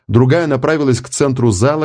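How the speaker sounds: usual speaking voice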